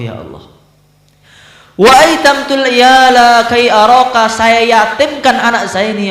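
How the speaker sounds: high, loud, fervent sermon cries; impassioned preaching. Acted. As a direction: preach it at full force, pleading to God. Impassioned preaching of the poem's meaning.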